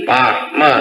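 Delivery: usual speaking voice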